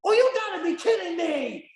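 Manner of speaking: imitated high-pitched commentator yell; Michael Cole yell. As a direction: cry it out like a wrestling announcer. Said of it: "instead of hearing Michael Cole yell".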